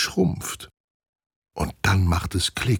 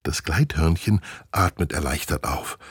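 usual speaking voice

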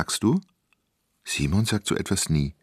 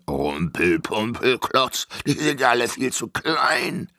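grumbling giant; 4 semitones higher than usual. Acted.

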